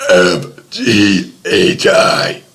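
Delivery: burped letters, slow; burping the words. Pretend. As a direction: croak the letters out on a burp. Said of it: "Gonna be burping the A B Cs".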